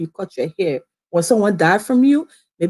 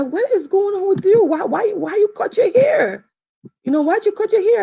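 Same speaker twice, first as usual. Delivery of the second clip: high, shocked questioning; dad confronting her about the haircut, shocked; 12 semitones higher than usual. Retold or imitated. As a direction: demand an answer, astonished. Dad reacting to cut hair.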